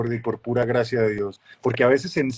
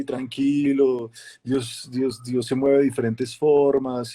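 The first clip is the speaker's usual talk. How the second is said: slow, drawn-out mock-serene church leader; calm preacher voice; slower than usual. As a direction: stretch it out like a stoned-calm preacher. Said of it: "porque todos hablan así".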